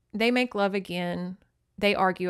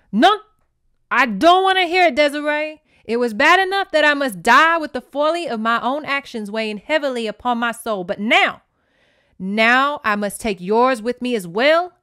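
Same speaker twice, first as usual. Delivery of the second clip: loud, higher anguished outburst; horrified father; 3 semitones higher, 7 dB louder than usual. A narrator's reading. Papa scolding his daughter, loud anguish. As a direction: rail at her in shame. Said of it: "he demanded, horrified".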